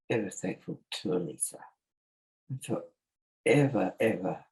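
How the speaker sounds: fighting back real tears; after crying. Real, not acted. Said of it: "sorry to reach that".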